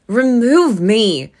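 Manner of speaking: loud, slow, anguished cry of "Remove me"; exclaimed. A narrator's reading. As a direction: cry out in despair. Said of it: "Scrooge exclaimed".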